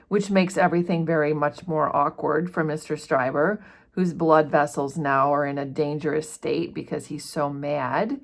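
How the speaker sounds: ordinary talk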